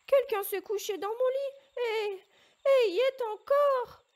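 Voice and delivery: very high, loud squealing cub voice, slowing at the end; tiny bear's little voice. A narrator's reading. Little bear crying out to his family that the girl is still in his bed, very high and loud. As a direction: shriek it like a startled tiny bear. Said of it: "cria le tout petit ours de sa toute petite voix".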